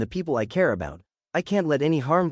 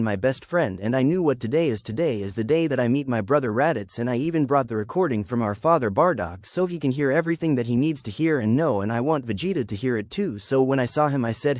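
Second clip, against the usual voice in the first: plain reading voice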